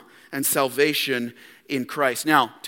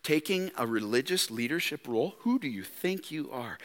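low, much softer sneering question; hushed accusation; 4 semitones lower, 11 dB quieter than usual. Retold or imitated. Devil whispering doubts to believers, soft sneer. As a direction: murmur it like a creeping doubt. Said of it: "they get to the point by saying".

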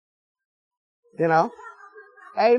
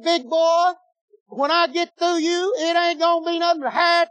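taunting giant; 6 semitones higher than usual. Acted. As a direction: sneer down at the little shepherd boy. Goliath boasts to David.

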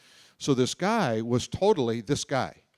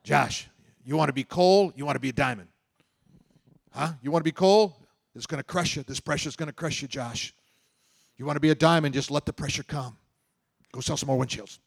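loud, pushy coal-or-diamond pep talk; pep-talking boss; 3 semitones higher, 5 dB louder than usual. Retold or imitated. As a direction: hammer him with the pressure speech. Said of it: "talk like this".

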